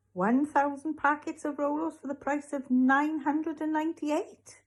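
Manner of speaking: high-pitched showman's offer; eager hard sell. Acted. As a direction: pitch it high, tempting the customer upward. Raj escalating absurd offer.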